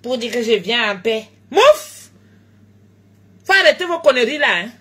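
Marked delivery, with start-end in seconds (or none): 1.5-2.1 high, loud mocking scoff; a sharp high scoff at the critics; high-pitched dismissive mouf. Real, not acted. Scoffing exclamation at commenters.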